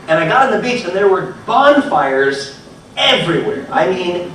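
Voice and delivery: ordinary talk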